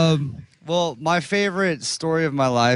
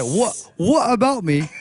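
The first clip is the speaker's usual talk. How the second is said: put-on British with a laugh in it; British accent. Asking what surprised his friend, mock British accent. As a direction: try British on every word. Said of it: "in a British accent".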